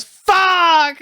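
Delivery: high, drawn-out frustrated yell; re-enacted yell. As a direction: yell it like you did back then. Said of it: "I just yelled at the top of my lungs".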